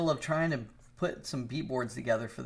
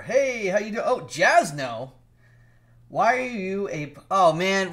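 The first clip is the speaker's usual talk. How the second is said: louder, higher, excited greeting; yelling at the screen; 7 semitones higher, 9 dB louder than usual. Real, not acted. Greeting Jasno in the chat, excited yelling. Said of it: "why am I yelling at the screen?".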